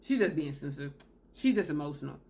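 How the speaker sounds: low, scoffing brush-off; gossiping about an upset woman; dismissive. Retold or imitated. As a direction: drop low and dismiss her. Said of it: "It's like".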